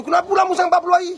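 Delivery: high, loud, fast whiny mimicry; sneering high-pitched complaint; mocking complaining parent. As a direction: mimic a parent whining about the wait. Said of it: "Ada orang kata".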